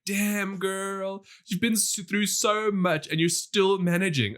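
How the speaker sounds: impressed, feeling for her, stretching the words out; sympathetic amazement. Acted. Cheering on a battered movie heroine, sympathetic amazement. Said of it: "'cause you're like".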